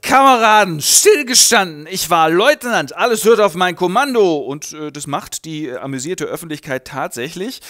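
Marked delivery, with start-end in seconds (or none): 0.0-4.4 barked commands. Retold or imitated. Heinrich losing it and commanding the crowd, loud military bellow. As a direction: shout orders like a drill lieutenant. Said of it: "er tickt aus und sagt".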